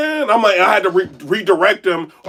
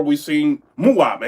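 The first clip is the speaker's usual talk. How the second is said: ordinary delivery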